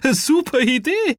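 very high, loud laugh running into the words. A narrator's reading. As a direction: burst out laughing, then speak through it. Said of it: "Jetzt musste Kaya laut lachen". (laut lachend, hohe Jungenstimme)

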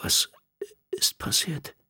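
shocked, breathless whisper. A narrator's reading. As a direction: barely get the words out. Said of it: "heraus kam nur ein Flüstern".

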